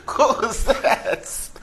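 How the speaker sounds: slow, wheezing laughter; can barely get the words out for laughing. Real, not acted. Still laughing at the Hola.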